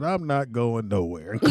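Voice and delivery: slow, low laughing refusal; low defiance. Retold or imitated.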